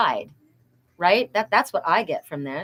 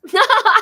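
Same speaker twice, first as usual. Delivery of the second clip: delighted high laugh; 14 semitones higher, 9 dB louder than usual. Real, not acted. Thrilled by the nickname, a high delighted laugh. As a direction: squeal of a laugh, far higher and louder. Thrilled at being called Pegasus.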